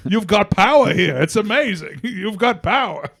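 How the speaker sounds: high, loud mock amazement; a hammed-up thrilled local; mock amazed. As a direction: gush over it like a miracle. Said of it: "You're like".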